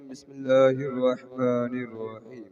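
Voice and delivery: Quranic recitation. Acted. Arabic khutba recitation.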